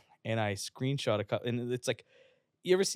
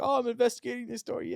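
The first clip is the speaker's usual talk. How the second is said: mock reporter voice; 13 semitones higher, faster than usual. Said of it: "I called, like".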